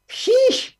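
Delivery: high exasperated exclamation; exasperated sheesh. Real, not acted. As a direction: throw up your hands, sheesh. Exasperated at educators' excuses.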